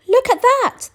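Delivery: very high, loud surprised cry; surprised skeleton. A narrator's reading. Big skeleton sees the dog in pieces, in a high surprised cry. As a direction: gasp out the discovery. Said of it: "the big skeleton said".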